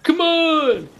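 high, drawn-out shout; long, high-pitched holler at them; playful yell. Pretend. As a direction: yell it high to get their attention. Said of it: "face towards them and yell".

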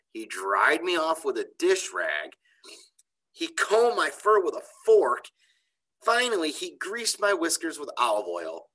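put-on lively, expressive story reading for kids; reading to children. A narrator's reading. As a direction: read it like a storyteller to a class. Teacher reads chapter to kids.

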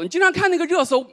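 ordinary talk

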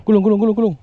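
high, loud repeated command; urgent shout. Real, not acted. Angler ordering reel in, urgent shouting.

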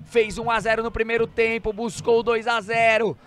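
ordinary delivery

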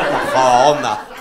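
Majadahonda loud, with swollen "o" vowels; posh mouth-full pronunciation. Shown as a demonstration. Comic asking where you said you live, exaggerated posh Majadahonda. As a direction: draw out Majadahonda as if the mouth were stuffed. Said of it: "se te mete una polla en la boca para decirlo".

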